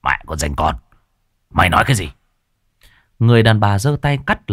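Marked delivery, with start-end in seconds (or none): 0.0-2.1 low, menacing growl through gritted teeth. A narrator's reading. Thug rising against the girl, through gritted teeth. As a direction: threaten her through clenched teeth. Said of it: "nó nghiến răng".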